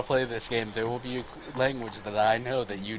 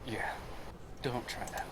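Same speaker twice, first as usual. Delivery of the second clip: glaring warning; 13 dB quieter than usual. Acted. Kaz glares at the sleazy arena man, quiet warning. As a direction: warn him off very softly. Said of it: "He glares at the man and goes".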